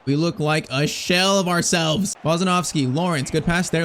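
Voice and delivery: ordinary talk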